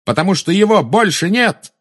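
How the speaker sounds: high, defiant shout back; Harry shouting back at Bellatrix. Acted. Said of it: "откликнулся Гарри".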